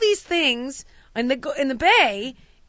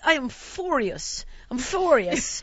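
mock Italian lady; 3 semitones higher, slower than usual. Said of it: "the Italian lady starts".

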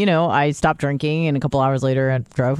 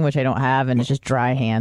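ordinary talk

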